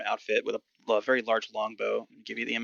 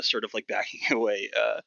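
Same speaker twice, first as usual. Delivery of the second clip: ordinary talk, nothing marked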